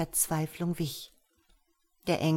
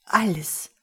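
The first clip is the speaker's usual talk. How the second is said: louder, gasped-out answer; 5 dB louder than usual. A narrator's reading. Gerber answers the angel's question, gasping. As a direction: gasp the word out. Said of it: "keuchte Gerber".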